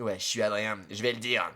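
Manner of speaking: deep low Adrien voice. Acted. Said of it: "dis-le, Adrien, dis-le".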